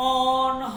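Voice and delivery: অনহ with a rounded অ, not আনহা; teacher stressing the right vowel; correct Persian reading. Shown as a demonstration. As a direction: say অনহ with a short rounded o sound. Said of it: "আমি কিন্তু আনহা বলি নাই".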